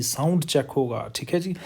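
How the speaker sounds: normal talking voice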